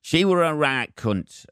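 put-on slow, high Leeds accent repeated; imitating a Leeds woman's verdict. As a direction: say it again in broad Leeds. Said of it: "she said in a fucking Leeds accent".

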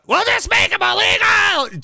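high-pitched shouted mimicry; mock shout. Host mocking those banning him, high mock shout. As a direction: yell it as a caricature of lawmakers. Mocking lawmakers in acted quote.

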